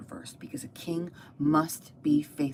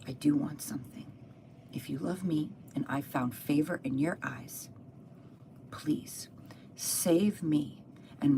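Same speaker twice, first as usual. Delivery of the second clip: soft, measured, imploring queen; earnest plea; 5 dB quieter than usual. Retold or imitated. Esther begs the king for her people, softly imploring. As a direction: plead quietly, pausing between phrases.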